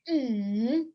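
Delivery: "mm" hummed down and then up; third tone. Shown as a demonstration. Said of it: "You go down and up".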